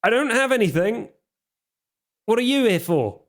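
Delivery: high, fast, frightened pleading; cornered and begging, voice up high; a cornered man's panicky high plea; scared pleading. Acted. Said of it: "presses himself against the wall, like".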